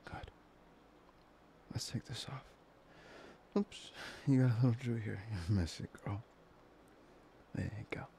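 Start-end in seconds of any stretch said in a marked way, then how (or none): none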